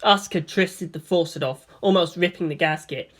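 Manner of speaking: plain reading voice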